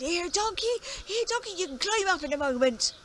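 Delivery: high, loud call down a well. Acted. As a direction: shout down to the donkey. Farmer calling down the well.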